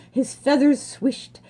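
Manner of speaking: plain reading voice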